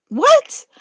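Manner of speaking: high, loud squeal of shock. Acted. Godzilla stunned by underwear news, shrieks. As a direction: shriek it in disbelief.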